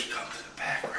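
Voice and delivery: faint ghost whisper, very soft; ghostly whisper. Retold or imitated. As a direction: let it float in as a soft whisper.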